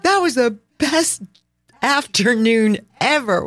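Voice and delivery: high, gushing delight; excited gush. Retold or imitated. Telling friends about the truffle hunt, gushing high. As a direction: rave about the best afternoon. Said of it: "we're like".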